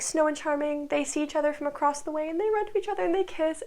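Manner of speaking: ordinary talk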